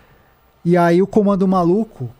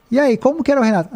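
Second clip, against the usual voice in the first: high, quick mock interview question; imitated interviewer; 9 semitones higher, faster than usual. Wife interviews the maid for her channel, imitated high, quick questioning. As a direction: toss out a leading question, pitched up and fast.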